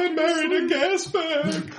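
high, slow wailing wife; whiny wife voice. Pretend. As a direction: wail it like a fed-up wife.

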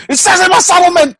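high, loud comic protest; mock indignant demand. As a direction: pretend to insist on your entitlement. Acts out demanding his settlement barbacoa.

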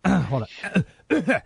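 clearing a blocked throat; something stuck in throat. Real, not acted. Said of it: "I had something stuck in my throat".

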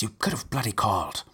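ordinary delivery

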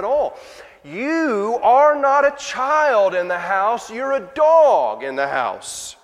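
harsh loud put-down, words dragged out; stern rebuke. Acted. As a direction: thunder it down on her with contempt. Preacher voices Jesus' harsh rebuke.

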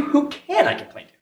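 ordinary delivery

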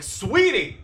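high, exasperated cry; angry pet name. Retold or imitated. Boyfriend arguing with his girlfriend, angry high pet name. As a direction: snap it out high like a reprimand. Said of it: "a weird thing to do angerly".

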